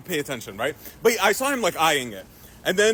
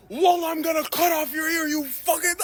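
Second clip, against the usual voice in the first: higher, shouting a mock threat; furious threat; 8 semitones higher than usual. Dad threatening to cut off his son's ear, mock shout.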